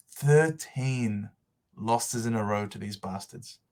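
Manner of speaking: usual speaking voice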